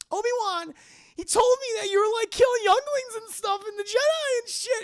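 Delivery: high, loud, whiny accusation; high whiny Padme. Retold or imitated. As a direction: squeal it like a panicked girlfriend. Padme accuses Anakin, acted.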